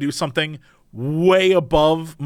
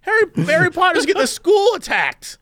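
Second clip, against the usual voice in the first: higher, whiny and exasperated; whiny complaining voice; 11 semitones higher than usual. Pretend. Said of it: "I'd be like".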